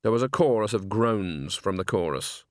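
usual speaking voice

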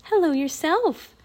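high, slow friendly greeting; smiling. A narrator's reading. Annie answering the tiny raven, smiling warmly. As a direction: greet a baby bird with a smile.

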